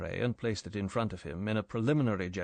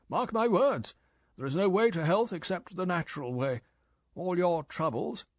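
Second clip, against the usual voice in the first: louder, higher preaching boss; emphatic lecturing chief; 8 semitones higher, 4 dB louder than usual. Acted. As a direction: hammer the point home.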